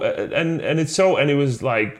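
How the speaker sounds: ordinary talk, nothing marked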